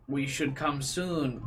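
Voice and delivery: slow, lightly imitated struggle with English; unsure English reader. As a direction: imitate someone unsure how to pronounce it. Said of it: "doing a question mark in his head".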